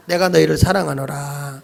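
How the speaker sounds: low, solemn voice of God; God's declaration. Shown as a demonstration. Preacher cueing the choir as God, low and solemn. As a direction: intone it low, lengthening the ending. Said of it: "성가대하고 하는 게 좋겠어".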